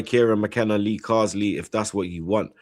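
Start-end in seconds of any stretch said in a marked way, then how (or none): none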